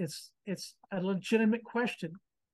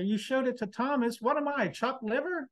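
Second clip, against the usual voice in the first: incredulous, higher and rising; indignant; 6 semitones higher than usual. Retold or imitated. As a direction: demand proof, half-offended. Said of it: "I read that passage and I say".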